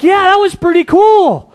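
high, loud delighted exclaim; acting out grateful disciples; enthusiastic agreement. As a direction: gush about how cool it was. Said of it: "they'd be like".

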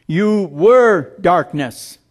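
"You were darkness" said slowly, "were" stressed; slow, lean on "were"; emphatic slow verdict. Shown as a demonstration. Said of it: "There's a difference".

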